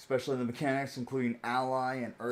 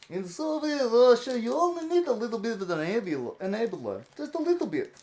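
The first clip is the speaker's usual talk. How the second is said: mock Russian accent; 9 semitones higher, 4 dB louder than usual. Streamer jokes to chat about needing an enabler, mock Russian accent. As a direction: ham up a Soviet Russia joke voice in a Russian accent. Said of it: "the accent is from Bratislava".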